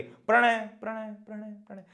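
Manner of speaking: whole word repeated, each time fainter; echo. Shown as a demonstration. Teacher showing an echo on a mountain, fading repeats. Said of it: "इसको मैं एको बोलता हूं".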